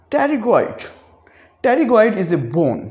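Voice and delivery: pterygoid said with a silent P, starting at the T. Shown as a demonstration. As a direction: drop the P in pterygoid. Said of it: "पी को खामोश कर देंगे".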